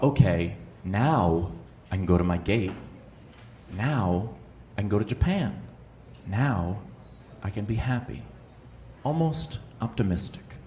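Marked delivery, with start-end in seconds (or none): 0.0-8.2 relieved inner voice. Retold or imitated. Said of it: "and I thought".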